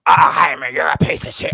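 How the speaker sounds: loud jeering imitation of a fan; mimicking fight fans trashing a boxer, loud mock yell; mock fan heckle. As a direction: bark it like a heckler in the crowd. Mocking Mexican fans jeering Jaime.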